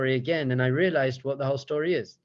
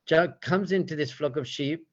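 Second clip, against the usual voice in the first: ordinary delivery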